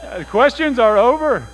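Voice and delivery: mock surrender. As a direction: declare defeat theatrically. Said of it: "I was like".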